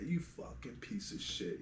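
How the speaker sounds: whispered curse under the breath, slowed; hissed insult. Retold or imitated. Barber whispering a curse at the returning client. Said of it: "And I was like".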